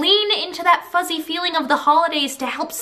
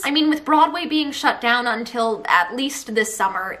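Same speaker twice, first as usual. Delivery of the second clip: ordinary talk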